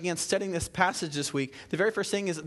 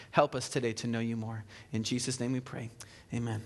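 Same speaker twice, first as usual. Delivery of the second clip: softer, lower prayer voice; 4 semitones lower, 4 dB quieter than usual. Acted. Pastor leading the church in prayer, soft reverent prayer. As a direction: pray quietly and reverently.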